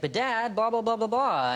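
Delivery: lightly acted son running through his lines; audition line-reading. Acting his part in audition scene.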